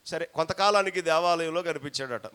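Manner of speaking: ordinary delivery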